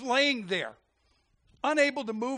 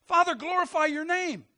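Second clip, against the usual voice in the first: high, raised preacher's plea to the Father; crying out in prayer; 8 semitones higher, 5 dB louder than usual. Acted. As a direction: call it out to heaven.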